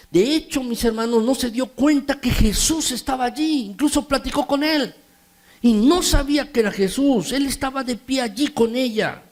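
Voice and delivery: high, rising preacher's fervour; fervent preaching. Real, not acted. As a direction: drive the point home to the congregation. Preaching builds to a peak.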